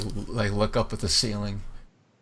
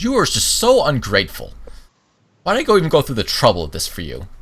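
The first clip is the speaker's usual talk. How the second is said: higher and louder, scolding fast; ungrateful scolding; 4 semitones higher, 5 dB louder than usual. Acted. Sister slams the table and scolds her ungrateful brother, loud and fast. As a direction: hurl it at him as you storm up. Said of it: "pounds the table ... says".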